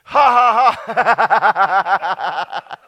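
booming ha ha ha; loud laugh. Real, not acted. Preacher laughing from the pulpit, loud laugh. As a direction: let a big laugh burst out.

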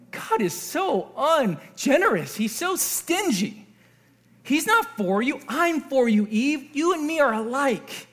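mock-conspiratorial serpent, slow on the insults; insinuating Satan. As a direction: play Satan lightly, stretching the complaint. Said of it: "And Satan's like".

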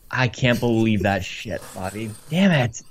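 ordinary talk, nothing marked

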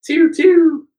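high, loud "pew" of a ray gun; imitated laser shot. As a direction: mimic a beam weapon firing. Imitating the beam weapon firing.

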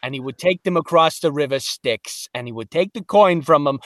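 streetwise New Jersey trainer's voice; New Jersey accent. Acted. New Jersey boatman training the new guy in charge of death, New Jersey accent. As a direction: talk like a New Jersey guy showing the ropes. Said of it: "a New Jersey. Uh, he was".

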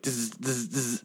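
soft imitated buzzing zzz; buzzing electric hum. Host imitating the buzz of failing lights. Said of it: "it's just like".

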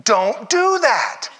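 very high loud yell, slowing on don't do that; laughing through a hammed-up shout; comic yell. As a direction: bellow it at her, over the top. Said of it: "He goes".

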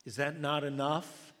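loud, exasperated question; frustrated retort. Retold or imitated. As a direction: demand it, fed up. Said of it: "I'm getting frustrated, more and more frustrated".

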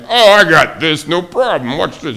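hammed-up overconfident dad; cocky handyman. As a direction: brag like you've got it handled. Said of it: "And you're like".

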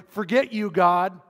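a hammed-up loud dismissal of God; defiant outburst. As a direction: fling it at God in a mock rage. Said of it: "It's not praying".

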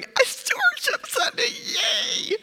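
high squealing hammed-up cheer; mock cheer. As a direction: squeal it like a fake-thrilled congregation. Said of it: "sitting there going".

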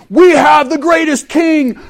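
booming war-cry shout, slow and drawn out; rallying shout. Retold or imitated. Preacher voicing a warlord rallying troops, big shout. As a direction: rouse the army like a warlord.

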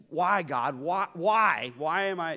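loud, pleading why cried out high; anguished cry. Retold or imitated. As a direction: cry out to God in frustration, voice straining. Retold friend's anguish at God.

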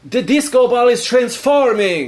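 put-on high, awed Italian accent; amazed Italian player. Player watching a mimed ball change, amazed. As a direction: marvel at the imagined disco ball.

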